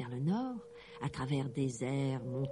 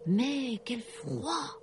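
quel froid; 8 semitones higher, much slower than usual. A narrator's reading. Narration frissonnante.

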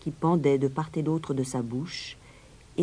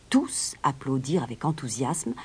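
usual speaking voice

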